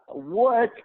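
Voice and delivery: high squeaked what; startled high what; shocked what. Retold or imitated. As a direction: yelp it in disbelief. Recognized a second time.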